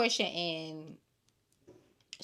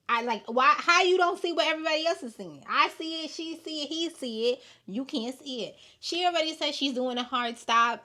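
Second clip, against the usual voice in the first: higher, louder and fast, disbelieving; exasperated; 7 semitones higher, 7 dB louder than usual. Real, not acted. Host baffled that Shamea can't see it, exasperated. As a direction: throw up your hands and demand why.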